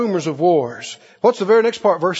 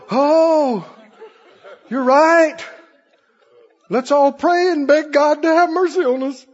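high, pleading imitation of a scared flock; mock fearful response; 5 semitones higher than usual. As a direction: beg with exaggerated dread. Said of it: "They're wanting us to all go".

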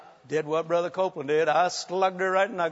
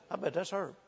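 much softer, half-whispered aside; leaning-over aside; 12 dB quieter than usual. Retold or imitated. Husband whispering to his wife in church, soft. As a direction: lean in and tell your wife quietly. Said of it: "I leaned over to Carol".

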